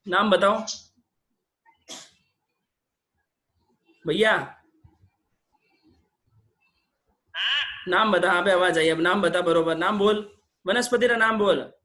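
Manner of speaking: loud coaxing over the phone; urging loudly. Real, not acted. Urging caller on weak line.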